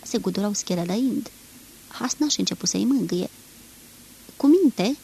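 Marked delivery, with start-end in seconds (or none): 4.4-5.0 firm raised command to calm dogs; loud command, first take. Acted. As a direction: order the dogs to settle. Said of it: "le poruncea el".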